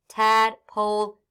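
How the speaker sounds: tadpole stretched slowly, syllable by syllable; slow blend. Shown as a demonstration. Teacher modelling Tadpole for young readers, slow and clear. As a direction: draw out Tadpole slowly, each part clear. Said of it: "blended word said slowly".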